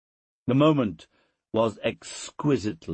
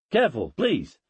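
high, cautioning plea; alarmed cry; 7 semitones higher, 3 dB louder than usual. A narrator's reading. Tutor warns uncle lifting the boy, high worried cry. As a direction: call out a worried warning. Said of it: "cried the tutor".

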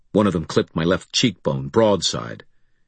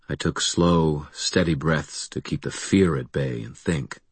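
plain reading voice

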